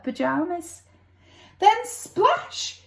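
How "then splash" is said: high excited "Then splash!"; exclaimed sound word. A narrator's reading. Narrator reading to child, excited splash. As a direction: burst out with the splash.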